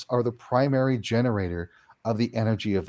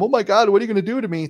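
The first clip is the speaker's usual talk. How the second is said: much higher, fast, hammed-up panic; frightened; 10 semitones higher, 4 dB louder, faster than usual. Old attitude toward the spirit: fast, mock terror. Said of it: "changing that attitude from".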